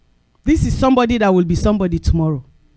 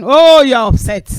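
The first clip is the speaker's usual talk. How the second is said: a sudden loud Oh, then the rest; mock indignation; 4 semitones higher, 7 dB louder than usual. Preacher mocking a driver who got cut off, loud Oh. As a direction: play the offended driver, half-joking.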